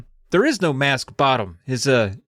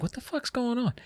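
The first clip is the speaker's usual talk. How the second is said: much softer puzzled dog voice; confused dog voice; 8 semitones higher, 10 dB quieter than usual. Retold or imitated. Sparky backs away from Steve, soft baffled dog voice. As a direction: voice the dog's baffled thought.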